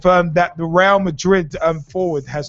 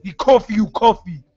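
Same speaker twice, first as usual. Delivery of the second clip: wrestler's promo in a put-on Ghanaian accent; wrestling promo. Host imagining spiritualist cutting wrestling promo, hammed-up accent. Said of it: "you can imagine him cutting a promo".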